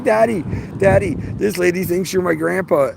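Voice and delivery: put-on high, bright child voice calling Daddy; excited little girl. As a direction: call out like an eager kid to her dad. Daughter quoted calling out to dad.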